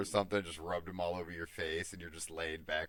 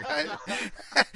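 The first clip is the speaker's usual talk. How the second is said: high playful hi; 12 semitones higher, 7 dB louder than usual. Retold or imitated. Character Killian's voice quoted.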